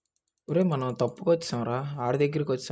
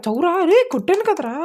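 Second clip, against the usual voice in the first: much higher, loud triumphant yell; excited shout; 12 dB louder than usual. Real, not acted. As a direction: crow over the hit. Gamer celebrating a hit. (ఉత్సాహంగా అరుపు)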